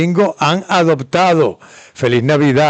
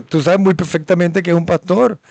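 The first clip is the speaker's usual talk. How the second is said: usual speaking voice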